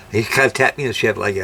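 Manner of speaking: ordinary talk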